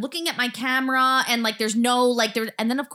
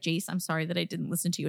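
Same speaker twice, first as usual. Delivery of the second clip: softer, fast apologetic tone; soft apology; 6 semitones lower, 9 dB quieter, faster than usual. Retold or imitated. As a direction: say sorry gently to a child. Mom apologizing to daughter.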